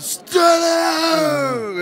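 loud, high screamed command; drawn-out, high-pitched stage yell; imitated scream. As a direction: mimic a performer screaming at the crowd. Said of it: "Why is he screaming so loud?".

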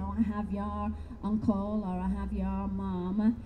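mock psychic medium.

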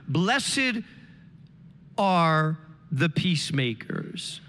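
slow, low, weighty scripture line; low, solemn reading of the beatitude; preaching scripture. Acted. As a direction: let the verse land slowly and low.

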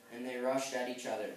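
plain reading voice

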